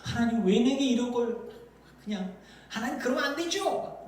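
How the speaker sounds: acted grievance climbing high; mock indignant prayer; resentful complaint. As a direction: protest upward, building in pitch. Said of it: "원망할 수 있는 그런 마음이".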